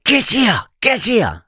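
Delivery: high, insistent calling; retold urgent shout. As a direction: bark the summons across the street. Man urgently summons the boy.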